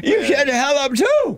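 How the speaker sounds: high-pitched imitated yell back; mock yelling. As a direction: mimic someone yelling back, pitch jumping up.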